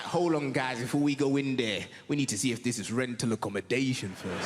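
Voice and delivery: deep, low mock mouse voice; low street-wise mouse voice. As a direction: play a mouse gang leader with a low voice. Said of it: "It's like".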